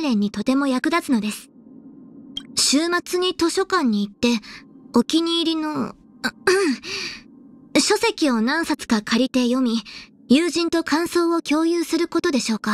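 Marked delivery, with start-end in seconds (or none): 6.2-7.2 polite little throat-clear. Acted. Officer interrupts her own list of hobbies, clearing throat. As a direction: clear the throat mid-sentence.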